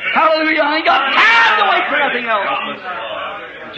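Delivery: high, slow, shouted praise; hallelujah shout. Real, not acted. Fervent preaching.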